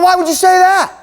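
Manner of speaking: loud, high indignant outburst; mad retort. Retold or imitated. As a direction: act out an offended man raising his voice. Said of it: "He got really mad at me".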